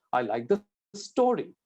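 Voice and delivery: "story" punched for contrast; stress on "story". Shown as a demonstration. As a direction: save the weight for the final word, "story". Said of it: "By emphasizing, you're showing the contrast".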